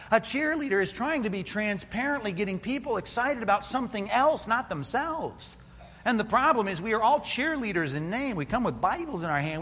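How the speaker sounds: ordinary delivery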